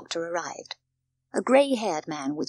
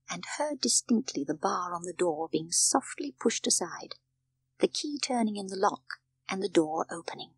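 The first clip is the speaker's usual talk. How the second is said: usual speaking voice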